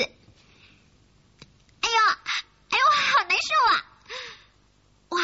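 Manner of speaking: high, fast groan through a held nose; pinched nose. Acted. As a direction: squeal it out with the nose pinched shut. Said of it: "用你的小手捏住鼻子".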